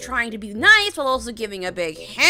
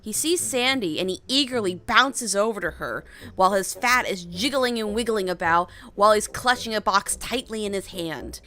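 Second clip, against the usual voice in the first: ordinary delivery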